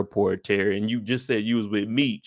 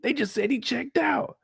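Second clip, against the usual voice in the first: high disbelieving; 12 semitones higher, 4 dB quieter than usual. Retold or imitated. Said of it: "You're like".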